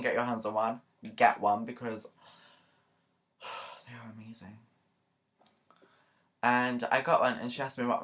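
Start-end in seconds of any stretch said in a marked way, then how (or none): 3.4-4.6 sighed, whispered rave; blissful sigh. Real, not acted. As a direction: whisper it on a long exhale, swooning. Swooning over the drink.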